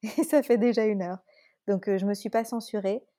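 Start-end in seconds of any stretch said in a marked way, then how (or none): 0.0-1.2 said through a laugh, a bit louder; surprised amusement. Real, not acted. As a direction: laugh at how long it ran. Laughs at running an hour.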